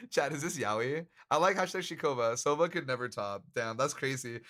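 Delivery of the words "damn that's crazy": usual speaking voice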